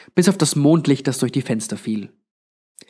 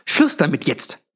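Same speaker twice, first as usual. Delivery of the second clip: sharp, slow rebuke; fauchend; 3 semitones higher than usual. A narrator's reading. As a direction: snap it as a cross old landlady. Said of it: "fauchte Granny Royce". (wütend angefaucht)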